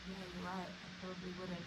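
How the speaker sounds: hushed, deflated imitation of the man backing down; sheepish admission. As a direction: give in quietly, a bit ashamed. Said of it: "he kind of was like".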